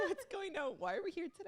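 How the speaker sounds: high, loud, laughing through words; giggly. Real, not acted. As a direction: giggle the whole question. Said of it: "I'm so giggly today".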